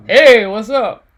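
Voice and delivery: loud, slow, laid-back guy; casual dude. Pretend. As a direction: greet like a chill buddy. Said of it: "he was like".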